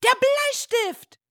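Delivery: put-on very high, loud child's shout. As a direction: shout the answer like an eager schoolboy. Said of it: "schreit Leo". (hoch und laut geschrien)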